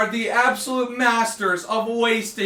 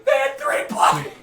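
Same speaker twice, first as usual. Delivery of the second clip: laughing; 7 semitones higher than usual. Real, not acted. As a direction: shout the names, crack up at the last. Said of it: "the reason why I'm laughing so hard".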